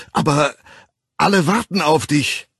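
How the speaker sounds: high, loud call through a locked door; shouting through a door. A narrator's reading. Bernard shouting at the locked door, loud and high. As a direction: yell so he hears you inside. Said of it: "Bernard musste die verriegelte Tür anbrüllen".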